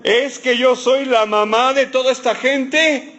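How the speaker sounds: a hint of a nagging mom; complaining mother. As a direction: sound like a fed-up mother. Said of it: "dónde he escuchado eso antes".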